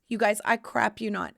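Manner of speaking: ordinary talk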